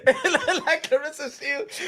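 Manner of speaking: laughing as he speaks; giggling while talking. Real, not acted. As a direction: laugh through the line. Laughing through words.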